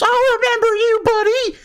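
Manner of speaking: extremely high, squeaky cry; anguished gingerbread buddy. Acted. Gingerbread man to his dying buddy, anguished. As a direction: wail it like a tiny cookie man.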